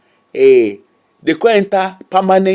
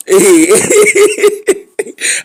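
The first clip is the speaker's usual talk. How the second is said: high, loud laughing near tears; helpless laughter; 12 semitones higher, 4 dB louder than usual. Real, not acted. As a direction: laugh until it nearly turns to crying. Laughing hard at chat.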